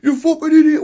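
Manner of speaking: high, loud furious yell; Davey's furious shout. Retold or imitated. Davey screams at Owen after being eliminated, furious high yell. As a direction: scream at your partner in rage. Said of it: "Owen was smiling, going".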